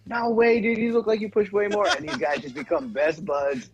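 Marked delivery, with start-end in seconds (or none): 0.1-1.9 hyped gym bro. Retold or imitated. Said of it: "He was like".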